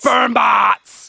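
shouted reveal over the uproar; triumphant announcement. Real, not acted. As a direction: call it out loud like a big headline.

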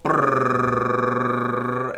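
rolled-tongue drum roll, loud. Pretend. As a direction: buzz it like a snare drum roll. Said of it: "Drum roll".

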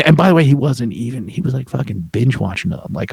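ordinary talk, nothing marked

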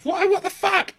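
high-pitched mock outcry; shocked girls. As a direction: imitate two girls crying out in shock. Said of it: "they notice the guy and they're like".

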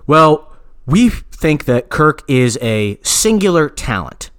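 slow, drawn-out imitation of the boss; slow mock corporate drone; imitating the exec. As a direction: drag it out like a stalling executive. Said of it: "and he goes".

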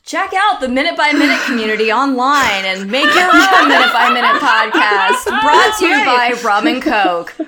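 loud promo announcer read. Pretend. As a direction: sell it like a radio ad. Mock ad read.

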